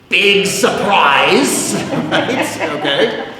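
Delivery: loud, slow, laughing sarcasm; drawn out mock surprise with a laugh; wry joke about predictable traffic; sarcastic. Real, not acted. Sarcastic aside about worse traffic.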